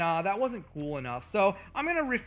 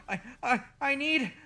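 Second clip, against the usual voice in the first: faking fainting; 6 semitones higher than usual. Pretend. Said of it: "I say as he gets closer".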